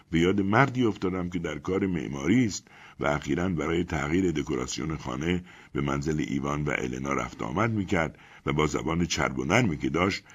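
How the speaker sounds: plain reading voice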